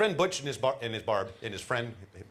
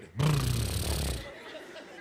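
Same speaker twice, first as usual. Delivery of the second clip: low gurgling mock laugh; deep bubbling laugh; 7 semitones lower, 3 dB quieter than usual. Shown as a demonstration. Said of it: "you just went".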